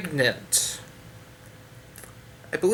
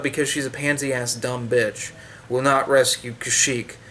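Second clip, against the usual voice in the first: normal talking voice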